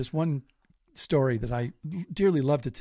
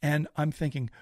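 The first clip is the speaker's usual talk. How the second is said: ordinary delivery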